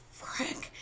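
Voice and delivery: breathy unvoiced frick; whispered curse. Passenger on the runaway plane, whispering in dread. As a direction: whisper it under your breath. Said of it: "And I was like".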